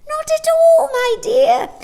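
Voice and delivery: very high, loud shrill chuckle-voice; chuckling old woman. A narrator's reading. Old shopkeeper chuckling to Jane. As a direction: dismiss it with a chuckle.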